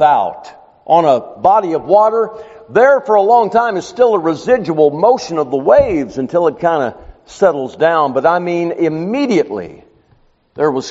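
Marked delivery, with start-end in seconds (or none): none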